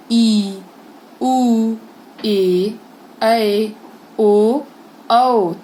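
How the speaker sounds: each vowel held long and loud. Shown as a demonstration. Teacher showing pupils long vowels, each held loud. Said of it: "जिसके उच्चारण में हमको ज्यादा समय लगे".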